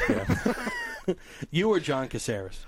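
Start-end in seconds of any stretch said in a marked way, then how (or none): 0.1-1.1 laughing. Real, not acted. Laughs after naming the quickest.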